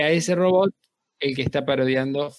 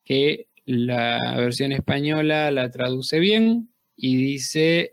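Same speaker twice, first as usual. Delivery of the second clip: ordinary talk, nothing marked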